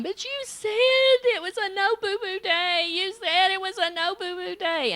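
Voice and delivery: put-on high, tearful little girl's protest; crying child. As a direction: wail it like a hurt small child. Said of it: "And she started crying".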